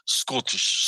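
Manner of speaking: Scottish in a thick Scottish accent, skoo-tish. Shown as a demonstration. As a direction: say Scottish like a Scot doing Bond. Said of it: "your really thick Scottish accent".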